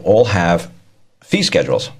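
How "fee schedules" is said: ordinary talk, nothing marked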